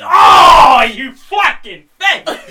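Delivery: loud, high outburst, laughing; mock outrage. Real, not acted. Friend hasn't heard of Andy Kaufman, mock outraged yell. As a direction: yell in fake disgust at him.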